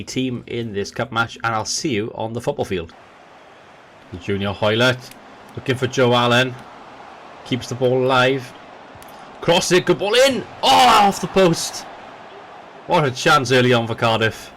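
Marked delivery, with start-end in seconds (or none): 4.2-8.5 football commentator calling the match; live match commentary, building with the chances; play-by-play commentary. Acted. 9.4-11.8 rising shout at the near miss; excited. Acted.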